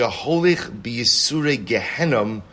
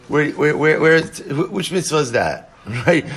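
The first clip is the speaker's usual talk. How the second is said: louder, higher stammered where where where, ending in a laugh; mock baffled question; 4 semitones higher, 5 dB louder than usual. Teacher voicing a baffled student's question, loud and laughing. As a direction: play the puzzled student who can't find the mitzvah.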